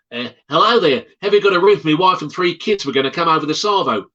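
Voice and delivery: a mock Australian caller with a broad accent.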